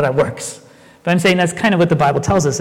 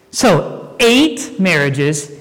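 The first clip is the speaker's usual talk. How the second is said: ordinary talk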